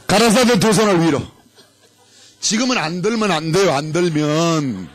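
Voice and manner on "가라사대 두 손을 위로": game command call. Shown as a demonstration.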